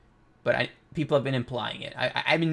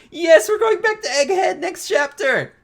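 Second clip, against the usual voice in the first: much higher, loud fan squeal; excited cheer; 8 dB louder than usual. Real, not acted. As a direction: shout it out with pure fan joy. Fan cheering about next chapter.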